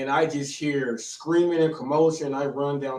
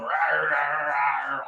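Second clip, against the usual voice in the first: imitating barking; slower than usual. A dad retelling the fight mimics the barking dogs slowly. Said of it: "dogs going".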